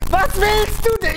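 high, loud exasperated outburst; fed-up retold yell; totally tilted. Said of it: "Ich war so richtig so, so tilt".

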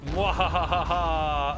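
slow evil cackle; mad scientist. Pretend. Villain laugh while playing.

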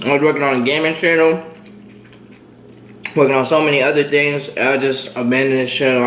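speaking between bites; talking while chewing. Real, not acted. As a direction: mouth partly full of noodles. Seen 0: Took a forkful at -2; mouth moving, chewing while talking.

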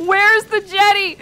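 loud, high, drawn-out call; comic desperate cry. Pretend. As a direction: belt it out high and slow in mock despair. Comic repeated search for generator.

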